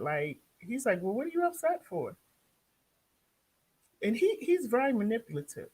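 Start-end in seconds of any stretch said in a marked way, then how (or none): 1.0-2.2 high-pitched, quick retort imitating the husband; higher, faster mock-innocent question; mock challenge. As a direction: imitate him throwing the question back. Retold husband challenges upset wife.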